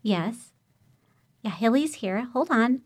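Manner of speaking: softly, like a distant phone voice; faint far-off phone answer; overheard quietly. Retold or imitated. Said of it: "And I hear".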